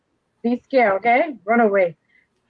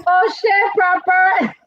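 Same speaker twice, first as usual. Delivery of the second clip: gushing praise; 6 semitones higher, slower than usual. Retold or imitated. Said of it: "praise my friend".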